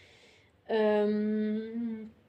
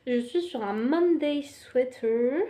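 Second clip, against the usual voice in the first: slow, mock English accent on French words; cheap English accent; 3 semitones higher, 5 dB louder, slower than usual. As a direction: put on a cheesy English accent for "Monday sweater". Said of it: "je prends un accent anglais à deux balles".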